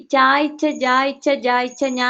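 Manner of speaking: ചായ്ച്ച, ജായ്ച്ച run together with an extra "ee" glide before each doubled ch; wrong linking vowel. Shown as a demonstration. Teacher mimics how students recite letters, ചായ്ച്ച with an added "ee" glide. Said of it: "ഈ അവിടെ വേണ്ട കേട്ടോ".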